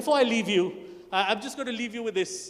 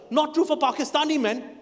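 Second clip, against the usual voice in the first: ordinary talk, nothing marked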